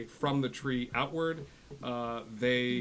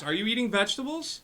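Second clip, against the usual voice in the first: high, raised-voice doting dad; fussing dad; 11 semitones higher, 8 dB louder than usual. Acted. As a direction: check up on your son, high and loud. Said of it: "it's Dad".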